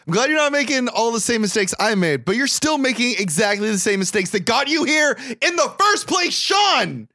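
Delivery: rising into a shouted scolding; mock angry dad. Dad berating his son Sean, shouting.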